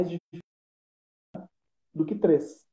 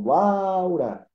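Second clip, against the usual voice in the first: "Laura" called out, LAU louder and higher; calling someone far away; 4 semitones higher, 5 dB louder than usual. Shown as a demonstration. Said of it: "como se a palavra estivesse lá longe". (chamando "Laura" de longe, LAU bem forte)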